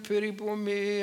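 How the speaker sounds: high, far-off mock wail; hammed-up plea of the wronged woman; mock screaming. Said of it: "and screaming".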